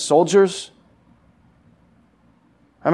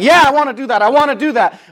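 fired-up mock eagerness; 8 semitones higher, 4 dB louder than usual. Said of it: "be like".

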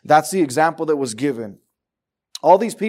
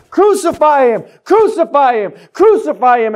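loud, higher mock crowd yelling; crowd's yell; 8 semitones higher, 6 dB louder than usual. Preacher voicing the mob demanding the crucifixion, loud shout. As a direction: holler it like the mob at the cross. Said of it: "yelled out and hollered".

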